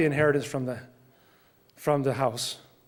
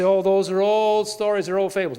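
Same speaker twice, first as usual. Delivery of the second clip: dismissive doubters; 4 semitones higher, 4 dB louder than usual. Acted. Preacher voices doubters of Bible miracles, mock-dismissive. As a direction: wave it away like a scoffer, stretched and raised. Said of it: "We say".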